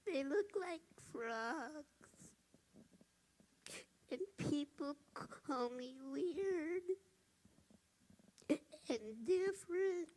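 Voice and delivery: put-on high, very soft, slow little girl; a little girl whispering her worries on the phone; sad little girl. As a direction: confide it like a hurt small child. Sad child explains her troubles.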